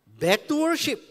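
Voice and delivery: loud high call to worship; rally the whole church; rallying shout. Real, not acted. (seruan lantang kembali menyembah)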